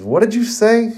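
high-pitched, incredulous question; startled question. Acted. Ino questions Choji's news, high and incredulous. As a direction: leap up high in disbelief. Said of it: "Ino then questions".

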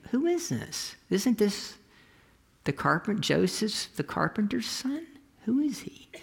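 high, incredulous questioning; puzzled townsfolk. Acted. Townspeople scratching heads about Jesus.

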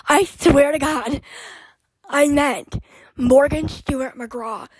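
normal talking voice

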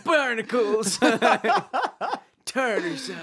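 high, slow cartoon old man grumble; SpongeBob old man. Laughing through a cartoon old man impression, high and slow. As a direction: grumble like a cartoon sea captain. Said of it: "an old person from SpongeBob".

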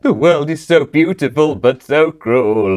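high, loud tearful wail; mock-weepy, drawn out at the end; mock crying. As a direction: wail it like a hammed-up cry. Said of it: "have a little bit of a cry".

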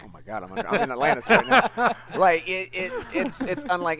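gasping through a laugh, pitch jumping up; laughing excitedly. Real, not acted. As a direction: laugh out the words, thrilled. Gushing over Atlantis trailer.

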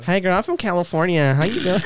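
put-on Californian girl greeting; mock Californian girl; Cali girl accent. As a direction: introduce yourself as a Cali girl. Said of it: "it's gotta be Cali girl".

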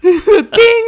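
high loud ding; imitated bell ding. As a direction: ring it out like a bell.

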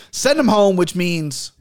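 normal talking voice

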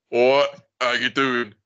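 lightly voiced Essex greeting, slowed down; Essex wife impression. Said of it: "exact voice you're doing".

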